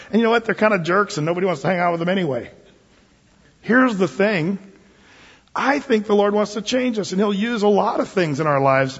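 usual speaking voice

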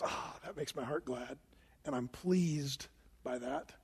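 soft, whispered tender voice of God; pleased God. Retold or imitated. God speaking fondly to his children, whispered and soft. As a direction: breathe it out softly, fondly pleased. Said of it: "he looks at us and goes".

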